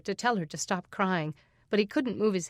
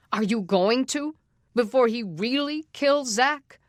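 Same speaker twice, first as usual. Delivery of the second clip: louder, higher demanding question; angry challenge; 4 semitones higher, 5 dB louder than usual. Acted. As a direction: press the sheriff hard. Father challenges the sheriff.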